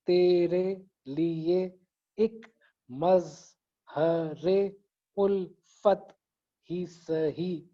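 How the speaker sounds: very slow, each syllable pulled apart; syllable-by-syllable recitation. Shown as a demonstration. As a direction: stretch the line into separate syllables. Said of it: "जहां पर आवाज टूटती है वहां पर एक सिलेबल". (हर syllable को अलग अलग खींचकर पढ़ना)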